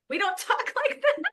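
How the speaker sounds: half-laughing high scolding; mock reprimand. Mom mock scolding her son, high and giggling. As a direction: pretend to scold while laughing. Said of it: "reprimand him for being like".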